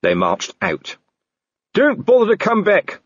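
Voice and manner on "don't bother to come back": much higher parting yell; shouting after them. Acted. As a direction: shout at their backs. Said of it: "Colon shouted after them".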